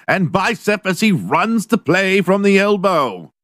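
high, slow, grand herald voice; Old English style. Acted. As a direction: declaim it like a medieval crier. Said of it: "fourteenth century Old English style".

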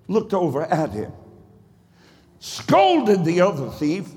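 rising, shouting preacher cadence; full-force sermon climax; preaching at full force. Acted. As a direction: preach it to the rafters, building.

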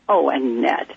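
usual speaking voice

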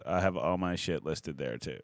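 ordinary talk, nothing marked

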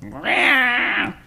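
high, drawn-out mock meow; imitating a cat. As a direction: meow like an anime voice actor paid only for animal sounds. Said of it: "and they're just like".

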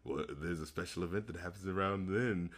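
low hushed teasing narration; quiet voice. Real, not acted. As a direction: hush it down like a secret. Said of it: "get into my whisper voice".

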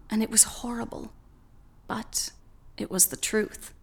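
unvoiced fast whisper. A narrator's reading. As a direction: whisper it quickly. Narration drops to whisper.

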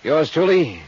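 brisk sign-off over music; expense report readout. Acted. Detective closing his report, brisk and dry. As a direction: read the report, dry and crisp.

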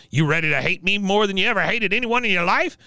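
high-pitched challenge in character; Cotton. Retold or imitated. Recapper as Cotton daring her to hate him, high-pitched taunt. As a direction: goad her, pitch climbing through the question. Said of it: "He goes".

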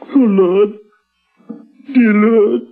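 grief-stricken. Acted. Father stunned with grief.

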